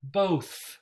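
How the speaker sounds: Both. con th final sorda y aire; voiceless th. Shown as a demonstration. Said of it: "think and bath and both and three".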